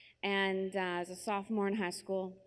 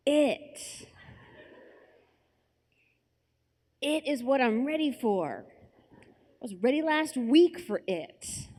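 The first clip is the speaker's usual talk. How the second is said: higher, louder, emphatic boast; mock overconfident; 5 semitones higher, 5 dB louder, slower than usual. Girl pretending she understands, hammed-up confidence. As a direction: act out a bluff of total readiness. Acted overconfident bluff about being ready.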